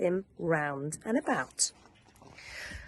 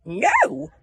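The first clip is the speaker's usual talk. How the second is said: refusal; 6 semitones higher, 9 dB louder than usual. Acted. Sheep refuse.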